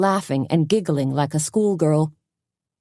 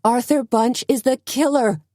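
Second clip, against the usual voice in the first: high accusing shout; 8 semitones higher than usual. A narrator's reading. Suzanne denouncing the killer to the crowd, high shout. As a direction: call out the killer's name. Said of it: "Suzanne shouted again".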